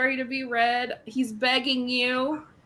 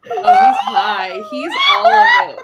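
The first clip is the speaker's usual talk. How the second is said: high, loud laughing yell; laughing shout; 7 dB louder than usual. Real, not acted. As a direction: shriek it through laughter. Hysterical laughter.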